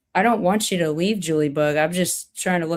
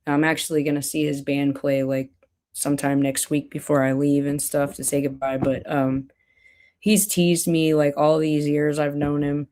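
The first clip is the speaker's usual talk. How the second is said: ordinary talk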